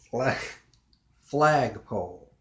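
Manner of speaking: flagpole drawn out, FLAG hit loud; slow, pondered "flagpole"; slow word. Shown as a demonstration. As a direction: stretch FLAG loudly, then drop on pole. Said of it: "slowly and think about the word".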